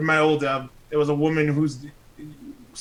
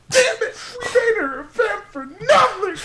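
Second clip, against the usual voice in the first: high laughing fake crying; mock lament. Mocking writers, laughing in a fake cry. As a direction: wail it through laughter.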